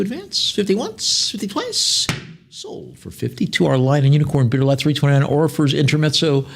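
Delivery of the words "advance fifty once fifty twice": high squeaky mock call. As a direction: squeak out the count like a cartoon. Squeaky joke voice on auction call.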